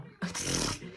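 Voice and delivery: low chuckle at the memory; amused laugh. Real, not acted. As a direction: chuckle mid-story. Amused by the remembered lingo.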